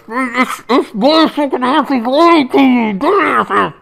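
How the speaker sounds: high, strained little captive voice; squeaky little guy. Acted. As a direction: shout it up high like a tiny hostage. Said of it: "the little guy being held goes".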